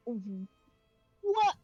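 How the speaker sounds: ordinary talk, nothing marked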